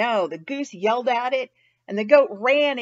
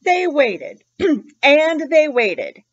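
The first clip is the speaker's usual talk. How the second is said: slower and louder with each repeat; reading to kids, dragging out the long wait; drawn-out waiting; 2 semitones higher, 9 dB louder, slower than usual. A narrator's reading. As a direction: stretch each phrase longer. Storyteller stretching the wait.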